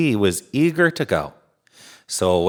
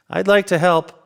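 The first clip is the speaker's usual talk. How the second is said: high, apologetic little engine; a small sorry engine voice; 9 semitones higher, 3 dB louder than usual. A narrator's reading. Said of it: "said the little yellow engine".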